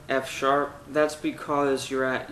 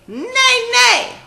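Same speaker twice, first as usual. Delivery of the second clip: much higher neigh, a touch louder; whinny it up high; light heady neigh; high horse neigh; 10 dB louder than usual. Shown as a demonstration. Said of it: "then say".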